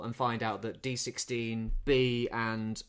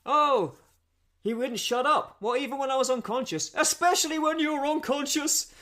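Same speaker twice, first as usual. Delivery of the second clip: high-pitched, exaggerated film dialogue; hammed-up retort, rising in pitch; acted-out bickering; 12 semitones higher, 5 dB louder than usual. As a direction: play the exchange up, pitched well above normal. Acting out a film exchange.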